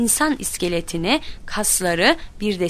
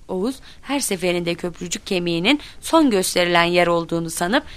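ordinary delivery

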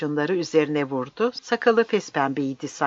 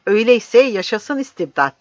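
loud, higher-pitched defiant cry; raised voice, old man's bitter protest; shouting; 6 semitones higher, 7 dB louder than usual. Acted. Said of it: "diye bağırdı".

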